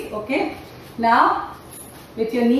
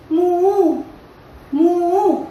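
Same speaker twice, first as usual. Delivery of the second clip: cow moo; 6 semitones higher than usual. Pretend. Cow sound for kids.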